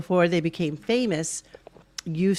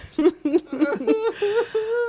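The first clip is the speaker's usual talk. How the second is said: high laugh; 12 semitones higher than usual. Real, not acted.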